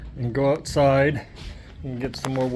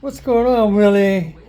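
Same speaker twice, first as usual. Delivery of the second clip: calling the dog; 4 semitones higher, 7 dB louder than usual. Real, not acted.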